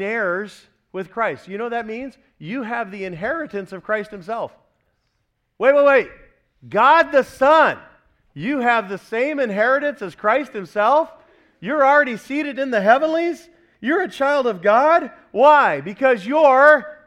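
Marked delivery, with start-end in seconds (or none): 5.6-17.0 much higher and louder, incredulous; astonished disbelief. Acted. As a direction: play the amazed listener who can't believe it. Acting an astonished believer's disbelief.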